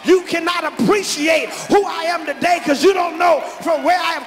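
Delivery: high, loud and slowing to the end; preaching at full force. Real, not acted. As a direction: build to a shouted climax. Preaching climax with organ.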